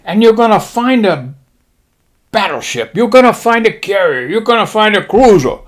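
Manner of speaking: high mock-Japanese admiral voice. Admiral briefing his pilots, high mock-Japanese voice. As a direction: announce it in a put-on Japanese accent. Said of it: "He says".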